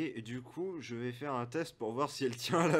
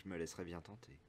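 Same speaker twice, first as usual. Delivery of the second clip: low, soft tavern woman; reply softly and coyly in character; soft NPC woman; 5 semitones lower, 13 dB quieter than usual. Acted. (voix douce de la cliente)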